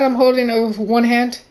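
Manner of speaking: usual speaking voice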